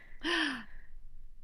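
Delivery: sharp, high gasp at herself; mock shocked gasp. Host gasping at her own gaming heresy. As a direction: gasp as if you said something forbidden.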